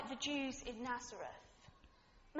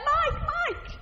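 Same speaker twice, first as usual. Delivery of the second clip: put-on higher, louder child calling out a name; mock little girl calling for his attention; excited little girl; 13 semitones higher, 12 dB louder than usual. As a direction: call out like an eager little girl running up. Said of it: "She's like".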